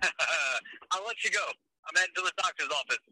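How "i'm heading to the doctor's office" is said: ordinary talk, nothing marked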